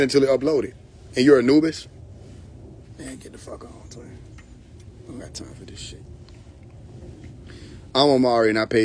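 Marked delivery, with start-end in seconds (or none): 3.0-6.0 very soft, nearly whispered brush-off; muttered dismissal. Acted. Dismissing the would-be Anubis, muttered almost in a whisper. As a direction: mutter it far under your breath.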